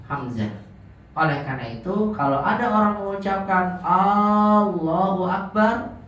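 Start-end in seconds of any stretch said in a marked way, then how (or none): 3.8-6.0 "Allahu" with its first a held long; drawn-out first syllable in "Allahu"; wrongly lengthened A. Shown as a demonstration. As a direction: stretch the opening A of "Allahu". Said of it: "sepakat para ulama nggak sah sholatnya".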